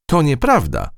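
high, mild objection; protesting. A narrator's reading. Mouse defends her fly friend, mildly protesting. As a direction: object with the voice lifted. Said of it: "zaprotestowała Matylda".

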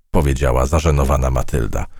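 ordinary delivery

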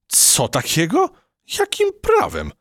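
high, outraged squeak; indignant. A narrator's reading. The mouse protests the cockroaches seizing her kitchen, indignant. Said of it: "oburzyła się myszka".

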